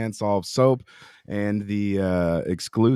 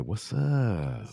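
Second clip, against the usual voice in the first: sick voice; 3 semitones higher, 3 dB quieter than usual. Acted. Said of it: "You get him with the sick voice".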